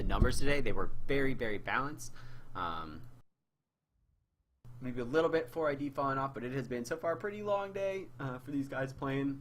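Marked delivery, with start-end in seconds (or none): 5.0-9.4 hoarse, worn-out voice; losing voice. Real, not acted. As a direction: voice giving out from talking. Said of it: "I'm, like, losing my voice".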